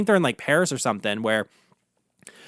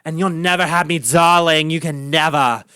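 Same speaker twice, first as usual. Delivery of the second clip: hammed-up old New York queen accent; old-school New York drag queen accent. Shown as a demonstration. Imitating Andy Warhol film queens, old-school New York accent. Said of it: "they kinda talk like this".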